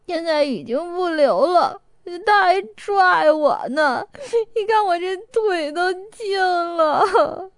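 high, slow tearful sobbing. A narrator's reading. Ge Yiqing in Chongwen's arms at the streetlamp, sobbing. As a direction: sniffle through each word. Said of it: "抽抽噎噎地说".

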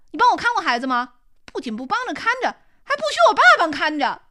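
fast, rising to a loud, high shout; angry outburst. Acted. Daughter accuses her mother of never helping, fast angry shout.

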